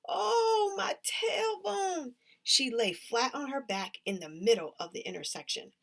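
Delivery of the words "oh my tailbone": high, slow half-laughing wail; pained wail. A narrator's reading. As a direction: whimper about the bruised tailbone. Tully falls and hurts herself.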